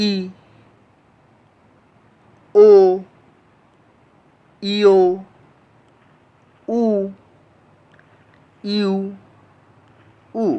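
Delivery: ই, ও, ইও, উ, ইউ, উ as isolated drawn-out vowels, long pauses between; vowel pronunciation demo. Shown as a demonstration. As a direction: recite ই, ও, ইও, উ, ইউ, উ one by one, held long. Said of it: "এটার উচ্চারণ হচ্ছে".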